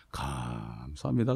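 low, soft, drawn-out thanks; murmured, long-held grateful thank-you; humble thanks. Retold or imitated. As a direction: stretch the first syllable out slowly, very quietly. Acted quote of grateful acceptance.

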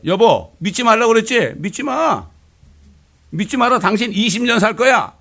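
high, loud proclamation; insistent declaring. Retold or imitated. Wife telling her husband he'll live twenty years, loud. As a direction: proclaim it with full conviction.

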